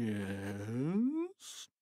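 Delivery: a flat dazed yes; barely awake mumble; hypnotized principal answering dully; mumbled. A narrator's reading. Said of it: "mumbled Mister Krupp".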